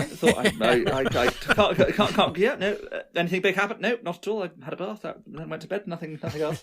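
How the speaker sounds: high, laughing, flustered denial. Retold or imitated. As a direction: laugh nervously while playing it down. Acting flustered and evasive.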